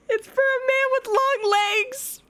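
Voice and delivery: very high, drawn-out laughing voice; cracking up, slow and high-pitched; laughing squeal. Real, not acted. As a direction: squeal it through real laughter. Cracking up at the name's meaning.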